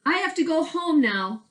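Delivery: calling into the log; sing-song call home. Acted. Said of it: "Cork called".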